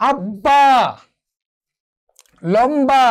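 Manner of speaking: আব্বা and লম্বা, each with a firm, full b; b fully pronounced. Shown as a demonstration. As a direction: hold the consonant, then sound the b in আব্বা and লম্বা. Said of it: "অবিকৃত থাকবে, অর্থাৎ উচ্চারণ হবে।".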